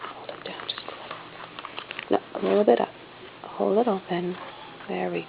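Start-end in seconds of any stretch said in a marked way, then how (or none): 0.0-3.8 whispered aside. Real, not acted.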